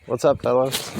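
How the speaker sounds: ordinary talk, nothing marked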